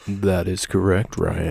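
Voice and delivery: booming game show host; announcer voice. Pretend. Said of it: "I'll get my announcer voice".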